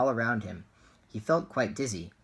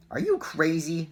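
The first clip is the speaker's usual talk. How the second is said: high, loud indignant shout; 7 semitones higher, 5 dB louder than usual. A narrator's reading. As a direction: shout at him in disbelief. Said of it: "shouted a woman".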